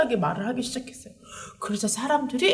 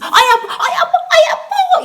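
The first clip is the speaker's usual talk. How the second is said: very high, loud, hammed-up whining yelps; mock cries of pain; 11 dB louder than usual. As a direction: squeal it like a child pretending to be hurt, half laughing. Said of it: "이렇게 하고 죽었어요?". (장난스럽게 과장한 아파하는 비명)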